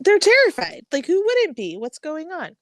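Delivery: ordinary talk, nothing marked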